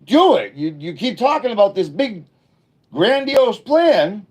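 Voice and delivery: high, loud fired-up urging; excited pep talk. Retold or imitated. Urging backyard friends to chase dreams, high and loud. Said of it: "I'm like".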